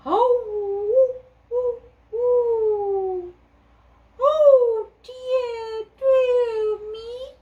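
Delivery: put-on high, wavering old owl voice; hooting owl. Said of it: "hooted the owl".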